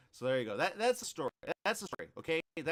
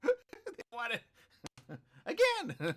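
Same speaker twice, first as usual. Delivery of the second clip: words broken up by laughter; giggling while reading the news; laughing; 11 semitones higher than usual. Real, not acted. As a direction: laugh through the lines, higher up. Laughing at the Botox story.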